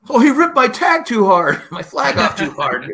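hammed-up high, loud, drawn-out complaint; mock whiner, stretching the words slowly into a laugh; Brady. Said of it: "Brady would complain".

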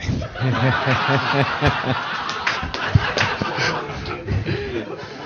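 long, unvoiced laugh. Real, not acted. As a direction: laugh it out at length. Laughs after own quip.

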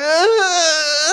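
loud high mock sobs; imitated crying. Little girl answering minister, imitated intense crying. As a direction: wail like an overwhelmed child. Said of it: "intense crying".

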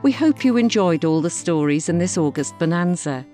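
ordinary delivery, nothing marked

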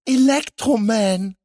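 high, loud, drawn-out call to the rescuer; relieved cry. A narrator's reading. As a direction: cry the hero's name with relief. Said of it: "rief sie erleichtert aus". (erleichterter Ausruf)

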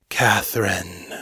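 low, slow call out of the dark; drawn-out eerie summons; voice from darkness. A narrator's reading. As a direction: beckon slowly from behind a door, low. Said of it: "the voice echoed from the darkness".

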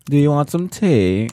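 mock-British, badly faked; attempted British accent. Shown as a demonstration. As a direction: have a clumsy go at a British accent. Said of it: "do a British accent".

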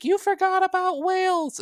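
very high, put-on whine of protest; mock angry complaint. Guest imitates offended Welsh listeners, mock angry complaint. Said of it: "last time people were mad".

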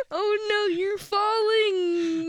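high, loud fake panic cry; hammed-up alarm at a fall; mock panic.

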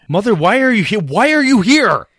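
high, flustered protest; exasperated son. Acted. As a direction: demand it like an embarrassed grown son.

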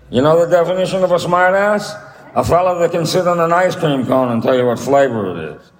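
put-on high corny old vaudevillian; a marble-mouthed vaudeville comic; George Jessel voice. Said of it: "George Jessel was like".

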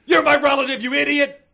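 high, loud yell; yelling at her brother in a high, loud voice; shouting. Acted. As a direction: screech it like a furious kid. Acted quarrel, shouted.

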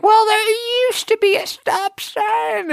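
put-on very high, drawn-out craggly old-man screech; accusatory old man. Old man arguing with newcomer over stop sign, indignant high screech. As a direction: insist like an aggro geezer, strained and high. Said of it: "this craggly old man".

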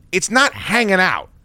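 ordinary talk, nothing marked

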